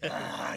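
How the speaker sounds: goofy, loud open-mouthed cry; imitated tongue-out noise. Host imitates wrestler going nuts, loud "ah".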